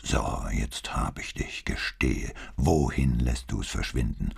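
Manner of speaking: hoarse, slow mock interrogation growl; gruff cop voice. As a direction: lightly growl like a bad cop. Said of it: "knurrte er mit heiserer, böse Bullenstimme".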